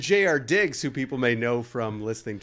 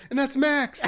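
high, louder, eager misidentification; excited; 13 semitones higher, 4 dB louder than usual. Retold or imitated. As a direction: gush the wrong name like a thrilled fan. Exterminator excited, wrong name.

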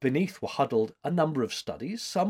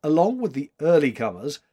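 ordinary delivery, nothing marked

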